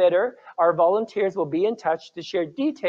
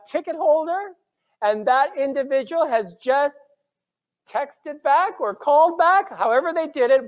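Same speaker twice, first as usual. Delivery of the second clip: excited; 7 semitones higher than usual. Real, not acted. Excited news announcement.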